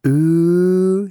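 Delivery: high, loud squeal of interest; a little girl cooing with delight; girlish ooh. A narrator's reading. Cicely cooing at the anniversary news, girlish. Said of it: "Cicely said girlishly".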